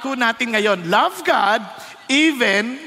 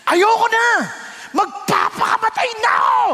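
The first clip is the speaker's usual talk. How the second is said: despairing shout; 11 semitones higher than usual. Retold or imitated. Frustrated man yelling to his friend, high despairing shout. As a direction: wail it like a man at the end of his rope. Frustrated man's outburst, retold.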